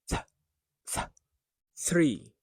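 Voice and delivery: breathy voiceless "th" alone, then the whole word "three"; isolated TH sound. Shown as a demonstration. Teacher letting students hear it, isolated TH. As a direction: isolate the th before saying "three". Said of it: "Can you hear it?".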